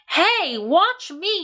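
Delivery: put-on high boyish yell. A narrator's reading. Avery yelling for everyone to watch him, high call. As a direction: call out for attention. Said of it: "yelled Avery".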